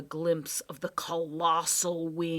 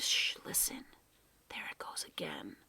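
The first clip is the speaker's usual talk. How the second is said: breathy hushed whisper; 3 semitones lower, 5 dB quieter than usual. Acted. Professor hushing the boys to listen, whispered. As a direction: hush them so the sound can be heard.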